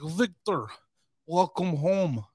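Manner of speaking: mock Russian official greeting Victor in a bad accent; terrible Russian accent. Russians welcoming the arms dealer home, bad Russian accent. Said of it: "That's a terrible accent".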